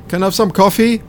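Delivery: high-pitched voice calling out; high passenger voice. Acted. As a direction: pitch it up high from further back. Passenger calls from rows back.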